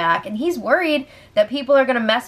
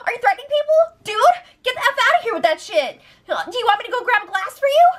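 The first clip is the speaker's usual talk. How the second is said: squeaky high, fast mock of a whiny guy; 11 semitones higher, faster than usual. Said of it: "pulls out his freaking high voice".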